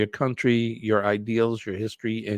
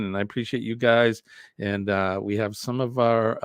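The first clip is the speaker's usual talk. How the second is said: ordinary talk, nothing marked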